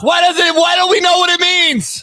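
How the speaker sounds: yelling in frustration, pitch way up; furious outburst at co-host; angry shout. Real, not acted. Yelling at co-host over a stat, frustrated shout. Arguing on air about DVOA.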